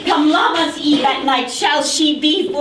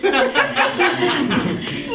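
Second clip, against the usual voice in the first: cackling laugh; 4 semitones higher than usual. Acted. Nurse laughs.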